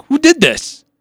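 mock outrage at the prank; indignant alarm. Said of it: "I'm like".

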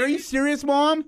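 high whiny protest; mock incredulous teenager; indignant teen. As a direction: squeal it like an outraged kid. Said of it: "I was like".

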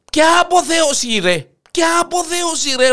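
loud, very high wailing protest; agonised outrage. Acted. Lion in agony rebuking the hare, agonised outrage. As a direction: cry out in outraged pain. Lion in pain rejects the ecstasy.

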